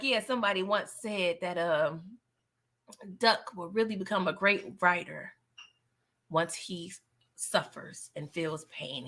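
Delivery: soft, slowing mock-solemn speech; dropping the voice low like a wise man; solemn hush. Duck's solemn speech retold.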